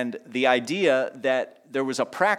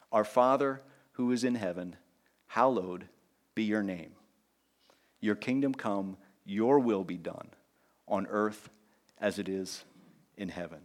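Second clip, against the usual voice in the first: soft reverent prayer; 4 semitones lower, 7 dB quieter than usual. Acted. Pastor leads the church in the Lord's Prayer, softly reverent. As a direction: pray it gently, like a hush.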